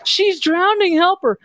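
very high squeaky cry for help; squeaky tiny doll voice. Retold or imitated. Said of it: "you know".

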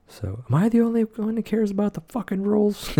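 high, far-off put-on angry rant; bad impression of an indignant man; Walter impression. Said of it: "Here's my Walter impression. It was very bad".

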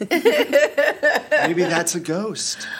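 slow drawn-out spooky tease; spooky ghost voice. Acted. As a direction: stretch it out like a campfire ghost story. Spooky joke.

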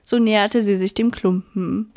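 plain reading voice

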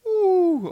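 loud woo of disbelief; high woo. Real, not acted. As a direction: let out an amazed woo. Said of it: "enough of the noise effects".

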